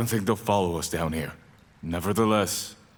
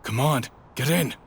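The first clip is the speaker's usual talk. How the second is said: higher, pressing shout; urgent call; 6 semitones higher than usual. Acted. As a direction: hurry him in. Urging friend into the sewer.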